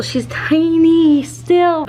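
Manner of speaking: high, loud, slow cooing; puppy talk. Real, not acted. Showing the little puppy to viewers, high cooing gush. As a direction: gush over how tiny she is.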